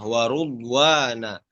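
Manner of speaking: WARUDWANA with ru, not ri; dhammah on ra. Shown as a demonstration. Teacher repeating the variant, dhammah in WARUDWANA. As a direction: say "warudwana" with a rounded u on the r.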